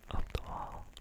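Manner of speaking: ordinary delivery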